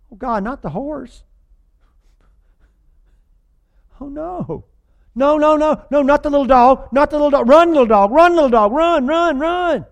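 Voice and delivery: high, sobbing-style pleas for the dog; mock weepy moviegoer. Mocking audiences who cry over a dog, high hammed-up wailing. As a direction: ham up the tearful panic.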